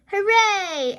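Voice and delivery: cheering. A narrator's reading. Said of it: "everyone cheers".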